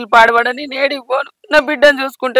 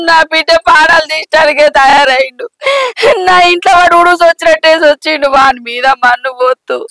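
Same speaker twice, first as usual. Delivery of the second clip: wailing curses through sobs; keen out each curse like a lament; crying, bitter imprecations; tearful cursing. Acted. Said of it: "గంతగానం ఎందుకు తిడుతున్నావు".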